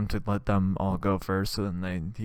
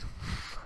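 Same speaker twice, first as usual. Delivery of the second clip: breaking up laughing; 12 dB quieter than usual. Real, not acted.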